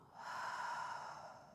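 long audible open-mouth breath out; long exhale sigh. Shown as a demonstration. Yoga teacher breathing out with listeners, long open-mouth exhale. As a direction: let all the air go through an open mouth.

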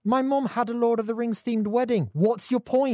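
high, loud, indignant comeback; exasperated arguing. Real, not acted. Heated argument over Lord of Rings.

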